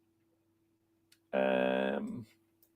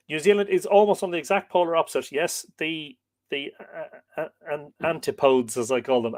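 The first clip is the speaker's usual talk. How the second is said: ordinary talk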